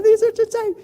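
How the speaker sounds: very high, loud squeezed squeak; comically strangled falsetto complaint; can't breathe. Retold or imitated. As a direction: squeal it as if the jeans are crushing you. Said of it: "I could not breathe. They were so tight".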